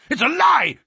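loud, very high-pitched cry; outraged shout. A narrator's reading. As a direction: shout a furious denial. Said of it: "he shouted".

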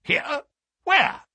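short, high-pitched startled questions; astonished. A narrator's reading. Colonel hearing the murderer is here, startled. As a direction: fire out each word in disbelief, high. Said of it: "stared at him in amazement".

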